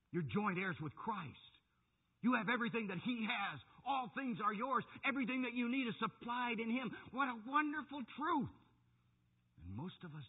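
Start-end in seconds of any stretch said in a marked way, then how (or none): 0.1-8.5 high, louder preacher's proclamation; fervent preaching. Real, not acted. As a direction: proclaim it to the congregation, building higher. Preaches with rising fervour.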